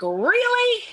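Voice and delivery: high-pitched incredulous question; exasperated disbelief. Retold or imitated. Coach questioning herself as the crying starts, high incredulity. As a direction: shoot the word up high in disbelief.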